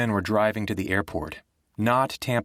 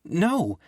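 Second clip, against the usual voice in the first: a firm, raised insistent no; 9 semitones higher than usual. A narrator's reading. Girl refusing Nick's plan, insistent no. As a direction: refuse him outright. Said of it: "shaking her head more insistently".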